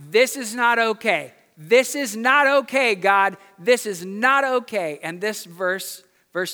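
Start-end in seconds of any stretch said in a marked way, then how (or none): 0.1-5.0 loud, emphatic cry of protest to God; raised-voice lament, hitting each phrase hard. Retold or imitated. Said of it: "The psalmist is crying out".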